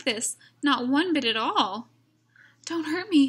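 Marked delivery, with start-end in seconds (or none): 2.6-3.3 higher, lightly frightened plea; mild whimper. Acted. Lion pleading with the mouse, a touch of a whimper. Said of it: "he whimpered".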